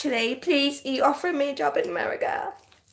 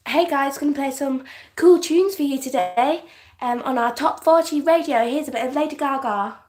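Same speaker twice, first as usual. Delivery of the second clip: peppy mock radio presenter voice; playful top-forty DJ patter, swooping pitch; put-on radio DJ; 3 dB louder than usual. Shown as a demonstration. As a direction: introduce the tunes like a hammy DJ. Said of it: "put on a weird voice".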